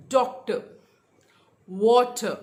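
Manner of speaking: DOC-tor, WA-ter, first beat punched; teacher's demo, Doctor and water stressed at the front; first-syllable stress. Shown as a demonstration. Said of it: "stress would be on the first syllable".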